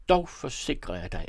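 ordinary delivery, nothing marked

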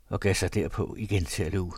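ordinary delivery

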